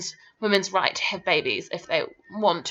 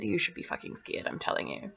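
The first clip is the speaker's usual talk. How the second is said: dramatic, ominous whisper, much softer; 9 semitones lower, 10 dB quieter than usual. Acted. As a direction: lean in and whisper the warning. Ominous warning to viewers.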